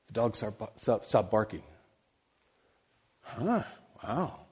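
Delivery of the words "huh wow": soft, high-pitched "huh" of wonder; quietly taken aback, musing; mildly amazed. Retold or imitated. Preacher recalls the dog suddenly falling silent, quietly amazed. Retold puzzled reaction to silence.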